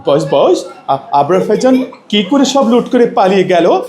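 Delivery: high-pitched cartoon mimicry; comic cartoon voice, pitched well up; Motu Patlu impression. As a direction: act out a cartoon character's baffled questions. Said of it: "মোটু পাতলুর কণ্ঠ নকল করা".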